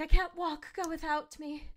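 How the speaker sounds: high-pitched, hammed-up wounded victim; mock helpless plea. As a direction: imitate a desperate injured friend. Voicing wounded character from film.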